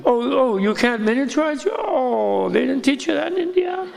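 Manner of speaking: high mock-innocent teasing with a laugh. Guru teasing his proud student about India, high and mocking. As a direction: needle him sweetly.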